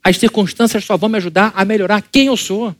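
ordinary talk

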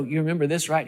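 ordinary talk, nothing marked

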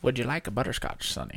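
lightly voiced old man; a hint of an elderly man offering sweets. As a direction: play it mildly elderly. Plays a ninety-five-year-old man.

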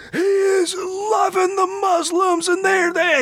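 high, slow, yelling caricature of a pundit; hammed-up conservative rant; mock right-wing ranter. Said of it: "they're over there like".

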